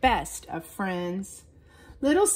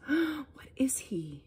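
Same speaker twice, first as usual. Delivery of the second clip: awed whisper; 3 semitones higher, 6 dB quieter, much slower than usual. A narrator's reading. Amazed whisper at new sprout.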